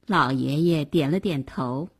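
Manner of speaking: usual speaking voice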